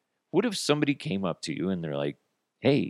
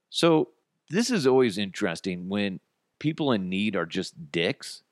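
ordinary talk

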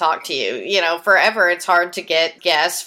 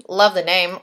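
ordinary delivery

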